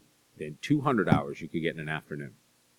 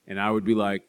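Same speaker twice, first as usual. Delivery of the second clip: ordinary delivery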